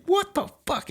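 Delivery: sudden high squeak of disbelief; incredulous outburst. Retold or imitated. Hearing a Marine got blue belt in six months, high disbelief. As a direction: react like you can't believe it.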